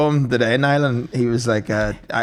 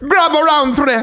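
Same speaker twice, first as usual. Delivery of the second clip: put-on Jamaican accent; 4 dB louder than usual. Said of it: "My mother's tongue".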